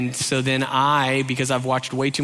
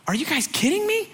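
very high squeaky disbelief; hammed-up high-pitched incredulity; incredulous; 14 semitones higher than usual. Acted disbelief at the kids.

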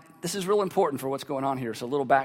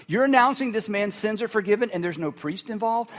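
normal talking voice

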